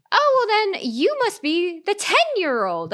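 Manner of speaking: high, slow, sugary condescending voice; sweet-talking an assumed child, high and slow; sugary stranger. Retold or imitated. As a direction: drag it out like a clueless guest. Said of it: "she turns to me and she says".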